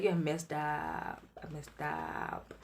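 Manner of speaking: slow, soft admission; quiet confession. Retold or imitated. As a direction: let it out slowly and quietly.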